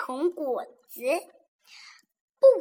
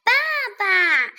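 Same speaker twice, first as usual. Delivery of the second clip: put-on loud childlike call; little one shouting for papa; 6 semitones higher, 7 dB louder than usual. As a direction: cry out for daddy. Baby dinosaur calls out.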